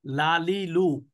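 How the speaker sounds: লালিলু drawn out slowly; slow syllable drill. Shown as a demonstration. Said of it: "Bangla উচ্চারণ করলাম না আরবি". (ধীরে লালিলু)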